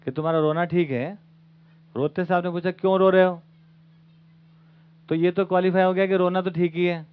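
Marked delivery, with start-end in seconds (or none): 2.7-3.4 a touch louder, a mother's concerned question; mother asking. Retold or imitated. Mother to crying child, lightly voiced concern. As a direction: ask the crying child what's wrong.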